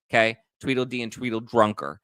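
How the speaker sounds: ordinary delivery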